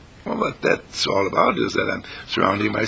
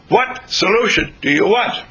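louder, higher; 8 semitones higher, 4 dB louder than usual. Shown as a demonstration. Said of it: "you don't have to shout like that".

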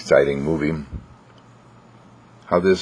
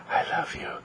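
hushed, very soft whisper; 11 dB quieter than usual. Acted. Owner snuggling the dog, whispering. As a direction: whisper it with affection.

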